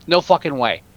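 normal talking voice

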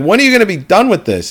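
high-pitched mock exasperation; exasperated nagging. Resident to the crew chopping up the ape, exasperated. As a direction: whine it like a fed-up neighbor.

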